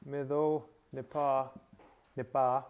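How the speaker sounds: "mido nipa" slowly, each word separate; slow enunciation. Shown as a demonstration. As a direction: enunciate "mido", pause, then "nipa", each stressed at its end. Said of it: "just to enunciate it".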